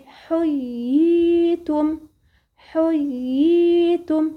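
حييتم very slow, clear doubled y then long ii; very slow tajwid recitation. Shown as a demonstration. Teacher models مد التمكين in حييتم. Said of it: "اسمه مد التمكين".

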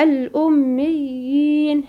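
الأميين very slow with doubled y and long ii; slow recitation. Shown as a demonstration. Teacher models الأميين as مد التمكين. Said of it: "هنا سمية هنا مد التمكين".

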